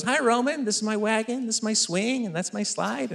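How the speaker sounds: put-on high, soft chirpy child showing off; little kid voice. Toddler shows neighbor his toys in a high chirpy voice.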